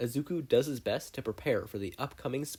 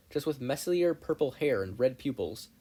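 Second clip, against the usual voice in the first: plain reading voice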